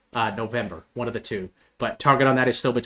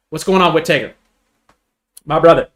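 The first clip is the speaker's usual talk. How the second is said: loud, raised pitch, cheerful call-out; loud greeting; 4 semitones higher, 10 dB louder than usual. Real, not acted. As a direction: call out to a friend across the room. Greeting a viewer by name.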